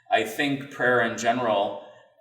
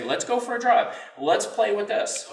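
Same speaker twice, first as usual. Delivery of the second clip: upbeat statement; 9 semitones higher than usual. Shown as a demonstration. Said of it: "You say".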